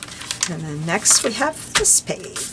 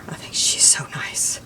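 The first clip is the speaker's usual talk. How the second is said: whispered; 4 semitones lower, 4 dB quieter than usual. Real, not acted. Fond aside about the neighbour.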